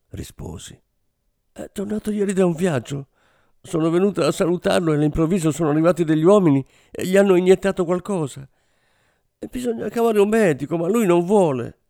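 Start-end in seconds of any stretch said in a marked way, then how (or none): 1.5-11.8 high, rushed tearful account; understated sobbing, voice much higher. A narrator's reading. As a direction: spill it out fast between tears. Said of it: "proseguì, singhiozzando".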